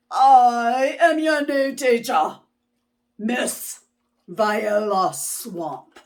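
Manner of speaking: loud, harsh schoolmarm introducing herself; stern witchy teacher. Acted. New teacher greeting the frightened kids, loud and harsh. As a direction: announce yourself to scare the class. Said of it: "rapped the desk with her ruler".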